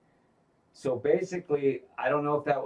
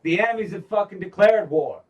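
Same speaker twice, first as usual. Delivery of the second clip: much louder and higher, yelling; angry shout; 8 semitones higher, 9 dB louder than usual. Retold or imitated. Daemon yelling at Rhaenyra in the war room, furious shout. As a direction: bark it like a furious warlord.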